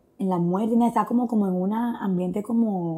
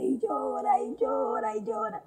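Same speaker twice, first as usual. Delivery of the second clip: imitating crying; 5 dB quieter, slower than usual. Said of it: "empecé a llorar y llora".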